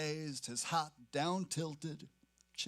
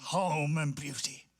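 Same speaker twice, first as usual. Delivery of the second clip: put-on slow, louder, rough old sailor voice; sailor's growl; 4 dB louder than usual. One-legged sailor growling at passers-by, slow and louder. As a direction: drag it out like a grudging beggar's cry. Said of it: "halted and growled".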